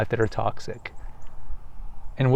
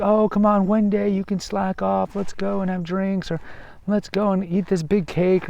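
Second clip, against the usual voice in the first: higher, wheedling tempter voice; coaxing friend; 6 semitones higher than usual. Retold or imitated. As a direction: imitate a friend luring you off your diet. Friend tempting you to slack off.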